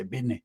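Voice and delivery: "business" with no S sound at the end; dropped S in business. Shown as a demonstration. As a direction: say "business" clipped to "bidne", leaving off the final S. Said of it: "they don't say the S at the end".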